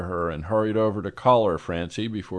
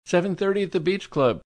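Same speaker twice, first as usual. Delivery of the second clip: high, bright delighted announcement; thrilled she'll come; high, delighted society lady; 10 semitones higher than usual. A narrator's reading. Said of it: "clearly delighted".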